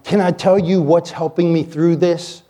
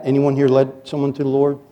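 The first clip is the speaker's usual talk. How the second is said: ordinary talk, nothing marked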